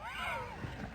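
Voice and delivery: high laugh. Acted. Game character laughs.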